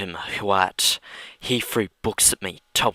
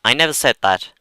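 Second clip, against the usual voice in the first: fast, loud objection; loud protest; 4 semitones higher, 9 dB louder, faster than usual. Real, not acted. [name] interrupting the reading, loud and fast. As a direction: cut in indignantly.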